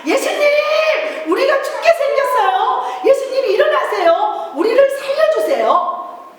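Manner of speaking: 예수님 shouted high and loud, pleading. Shown as a demonstration. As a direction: cry out with full force to wake him. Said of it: "큰 소리로 한번 예수님을 깨워봅니다".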